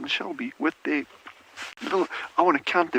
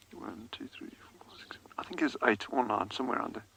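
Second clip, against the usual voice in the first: softly whispered count; 4 semitones lower, 10 dB quieter than usual. Real, not acted. Vlogger counting ducklings for viewers in a whisper. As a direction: count under your breath so you don't disturb them.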